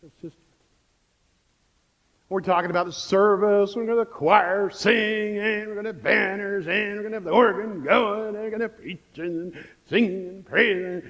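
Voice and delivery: ordinary delivery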